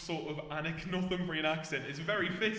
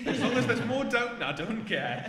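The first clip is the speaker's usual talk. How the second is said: laughing; 9 semitones higher, 11 dB louder than usual. Real, not acted. Laughing agreement about more Downton.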